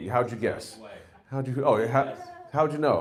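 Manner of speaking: usual speaking voice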